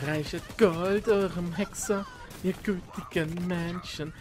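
slow, drawn-out bard's plea; bard imitation. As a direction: drag it out like a begging bard. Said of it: "Das musst du auch, auch ganz machen".

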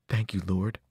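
lightly voiced humble creature; grateful zebra. A narrator's reading. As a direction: thank him reverently. Zebra thanking hero.

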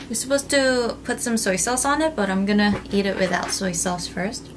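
normal talking voice

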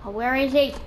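ordinary talk, nothing marked

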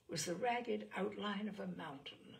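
ordinary delivery

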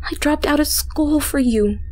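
high, swooping, pouty plea; wounded pleading. A narrator's reading. Stalker listing her sacrifices to her beloved, wounded pleading. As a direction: plead up high, drawing out the reproach.

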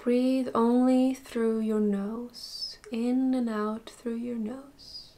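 soft, unhurried meditation voice with long pauses; guided meditation. Acted. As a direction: gently guide them through a body scan, slowly and softly. Leads body scan meditation.

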